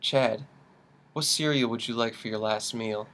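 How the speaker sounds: soft, somber narration; hushed grim storytelling. Acted. Host painting a grim morning for co-host, hushed and solemn. Grim scenario told as dark comedy.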